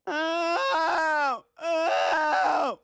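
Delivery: shrill ow cry; high pained yelp. Acted. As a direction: yelp in mock pain. Hurt by the monster.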